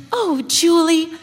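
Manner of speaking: put-on high, loud girlish "Oh, Julie"; the young Magnolia greeting Julie, high and loud; bright young greeting. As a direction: call out like an excited sixteen-year-old. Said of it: "that's how her energy would be and how she would speak".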